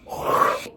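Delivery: sharp mimicked gasp of fright; startled gasp. Pranked classmate hearing of a wasp, mock gasp. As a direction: suck in air as if startled.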